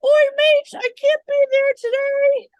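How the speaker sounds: high, loud attempt at an Australian accent; a bad, hammed-up Aussie impression. Host tries an Aussie greeting in an Australian accent. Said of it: "I don't know how to do Australian".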